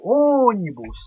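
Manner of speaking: "ônibus" with a long, strong ô; stressed first syllable. Shown as a demonstration. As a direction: lean hard on the ô of "ônibus". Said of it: "sílaba mais forte na pronúncia".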